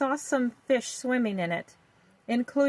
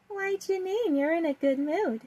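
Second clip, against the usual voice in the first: put-on little girl voice; 6 semitones higher than usual. A narrator's reading. Little sister Vicky voiced.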